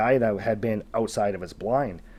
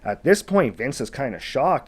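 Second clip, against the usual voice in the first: normal talking voice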